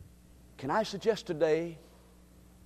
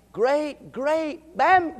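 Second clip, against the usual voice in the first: excited counting; 11 semitones higher, 8 dB louder, much slower than usual. Real, not acted. Counting greats in grandbaby.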